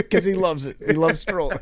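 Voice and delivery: laughing. Real, not acted. Laughing while teasing Hank.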